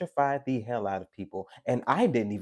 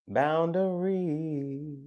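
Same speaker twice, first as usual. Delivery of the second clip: "Boundaries" stretched long and higher; drawn-out; 4 semitones higher, much slower than usual. Real, not acted. Reader dwells on boundaries, very drawn-out. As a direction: hold the last syllable of "Boundaries" long.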